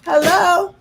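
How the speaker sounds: loud high insistence; high possessive squeal. Retold or imitated. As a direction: claim him, squealing. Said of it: "I was like".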